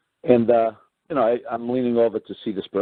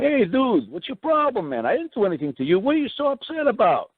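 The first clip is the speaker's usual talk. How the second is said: high, pleading protest; mock outraged victim; retold protest to the rough cop; 11 semitones higher than usual. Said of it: "And I'm going".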